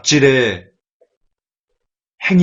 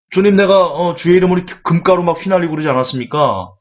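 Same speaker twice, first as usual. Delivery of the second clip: higher, louder, bragging to be recognized; boastful pleading believer; 5 semitones higher, 3 dB louder than usual. Acted. A boastful believer pleads with the Lord, higher and louder. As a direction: show off your deeds, pleading and boastful. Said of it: "이렇게 얘기를 하죠".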